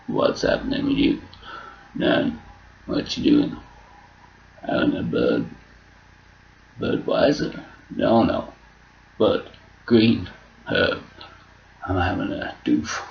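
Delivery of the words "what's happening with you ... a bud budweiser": mock stoner whisper. Shown as a demonstration.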